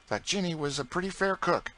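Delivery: put-on a cheeky boy egging the cook on; young George. A narrator's reading. George teasing Aunt Chloe about other cooks, boyish voice.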